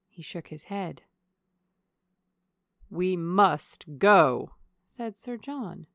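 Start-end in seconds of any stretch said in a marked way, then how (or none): 2.9-4.6 loud, slow, weighty declaration; emphatic insistence. A narrator's reading. Host refusing to cancel the outing, loudly insistent. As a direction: put your foot down, each word drawn out.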